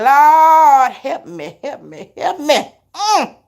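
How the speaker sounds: half-laughing prayer for help; mock desperate plea, cracking up. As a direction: beg the Lord through laughter. Pleading with the Lord, laughing.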